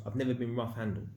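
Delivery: normal talking voice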